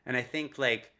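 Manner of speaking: normal talking voice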